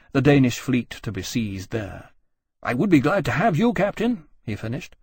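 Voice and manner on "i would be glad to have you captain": higher, easy cordial offer; a hint of genial officer; lightly voiced admiral. Nelson inviting the captain on his expedition, cordial.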